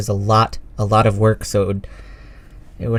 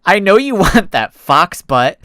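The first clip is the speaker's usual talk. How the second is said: laughing tease; 6 semitones higher, 6 dB louder, slower than usual. Acted. Echo teasing about the fox, laughing. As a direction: laugh through the teasing line.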